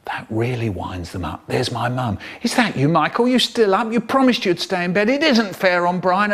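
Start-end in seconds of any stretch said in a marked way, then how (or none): 2.4-6.3 higher, indignant mum; lightly voiced telling-off; scolding mum. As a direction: tell off a child as mum. Mum scolding through the door.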